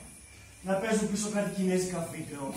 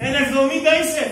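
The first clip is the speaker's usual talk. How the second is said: angry shout; 5 semitones higher, 8 dB louder than usual. Acted. Threatening rival over his grave.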